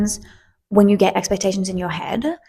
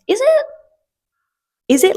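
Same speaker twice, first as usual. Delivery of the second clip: high, innocent "is it?"; mock-innocent questioning; playing innocent; 13 semitones higher, 3 dB louder than usual. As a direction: feign surprise to dodge the fee. Feigning innocence to the attendant.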